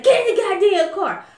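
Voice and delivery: high, loud command; barked order. Retold or imitated. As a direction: order her into the car. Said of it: "so he was like".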